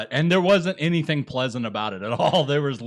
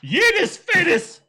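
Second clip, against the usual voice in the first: high slow squeal; shrieking; 8 dB louder, slower than usual. Real, not acted. Laughing shriek.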